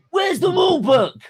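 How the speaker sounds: high, loud outraged yell; retold furious shout. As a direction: yell at the stewards like you've lost it. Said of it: "lose my shit".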